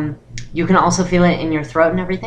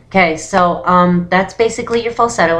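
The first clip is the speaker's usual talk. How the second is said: ordinary talk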